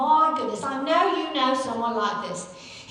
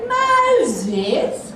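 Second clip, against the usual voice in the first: incredulous; 6 semitones higher, 4 dB louder, much slower than usual. Acted. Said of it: "He said".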